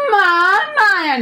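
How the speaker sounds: drawn-out, high, loud whiny wail of mom; slow exaggerated cry of mom for laughs; mock wail. Shown as a demonstration. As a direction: wail for mom like a spoiled kid, hammed up.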